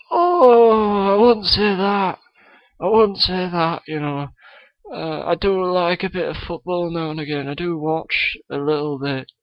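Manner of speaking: a kid's hammed-up Scottish accent; Billy Connolly impression. Guest Billy Connolly greets the podcast host, mock Scottish accent. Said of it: "Billy Connolly is on the show".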